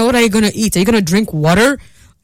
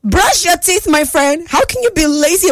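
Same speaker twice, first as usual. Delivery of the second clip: high, playful scolding; 7 semitones higher than usual. Real, not acted. Scolding listener.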